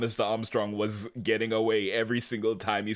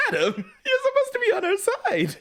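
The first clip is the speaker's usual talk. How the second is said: much higher, laughing protest; incredulous protest; 4 dB louder than usual. Retold or imitated. Host scolding Adam for turning on Cassie, high laughing disbelief. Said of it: "it's like".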